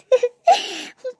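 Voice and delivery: loud high sob; acted helpless sobs; sobbing. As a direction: break down crying. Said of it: "ಅಳ್ಬೇಡ".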